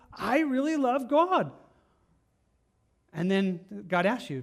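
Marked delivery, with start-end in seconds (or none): 0.1-1.5 slow, raised, smug claim of faith; self-assured churchgoer. Retold or imitated. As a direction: say it like a proud churchgoer sure of himself. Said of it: "sit in church and go".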